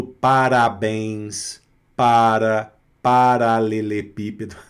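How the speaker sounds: slow "parabéns, para, paralelepípedo" with tapped R; tongue-tip R. Shown as a demonstration. Teacher shows the R French lacks, tapped in "parabéns, para, paralelepípedo". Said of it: "esse R falado na ponta da língua".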